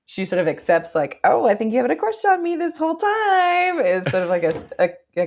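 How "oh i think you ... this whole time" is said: high sing-song tease; mock teasing. Retelling her accepting the crush, high and teasing. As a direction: pitch it way up and draw out the end.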